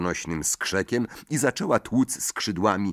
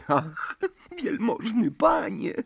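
sobbing house-elf; 14 semitones higher than usual. A narrator's reading. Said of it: "zaszlochał".

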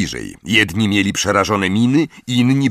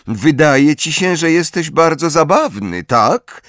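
high-pitched, cool challenge; coldly; 8 semitones higher than usual. A narrator's reading. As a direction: put him down coolly. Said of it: "zapytała chłodno".

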